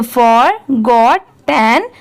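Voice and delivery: forgotten very slowly in three separate parts; teacher joining the pieces of forgotten, unhurried. Shown as a demonstration. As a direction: sound out for, got, ten with clear gaps between. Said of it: "इन तीनों को साथ में मिला देंगे".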